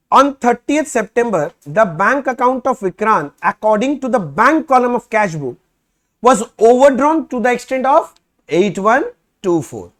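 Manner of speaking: slow, loud, word-by-word reading of the problem; reading the question aloud. Shown as a demonstration. Teacher reading the problem to students, slow and loud. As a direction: dictate each phrase slowly for the class.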